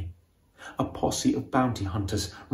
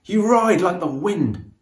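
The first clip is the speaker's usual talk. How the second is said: admiring call; 6 semitones higher, 4 dB louder than usual. A narrator's reading. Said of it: "he shouts".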